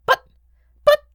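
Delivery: halting, cut-off sputter; stammering protest. Acted. Beetle lost for words before the Baron, stammering. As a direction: falter in shocked protest.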